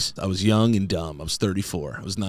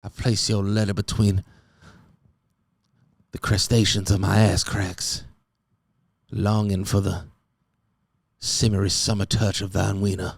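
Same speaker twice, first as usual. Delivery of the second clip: put-on old-fashioned love letter. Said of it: "the type of shit you had to say".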